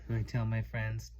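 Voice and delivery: usual speaking voice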